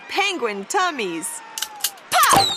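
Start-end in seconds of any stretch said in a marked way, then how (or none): 2.1-2.5 sharp, much higher and louder pop like a starting gun. Acted. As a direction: fire off the start. Start of the race.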